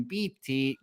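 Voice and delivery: normal talking voice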